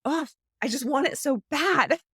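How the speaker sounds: groan-start, then playfully ache for it; hammed-up itch you can't scratch; mock craving. Voicing the craving for something new.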